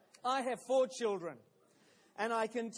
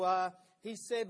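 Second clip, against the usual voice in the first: ordinary talk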